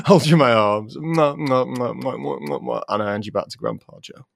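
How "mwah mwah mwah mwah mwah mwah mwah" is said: shower pretend kisses, trailing down in volume; string of playful smooches; kissing sounds. Kisses baby held in arms.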